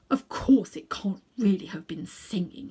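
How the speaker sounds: breathy whisper to himself; whispered thought. A narrator's reading. Uncle Andrew whispers to himself, nervous and unconvinced. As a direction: whisper it as if reassuring yourself. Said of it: "he thought".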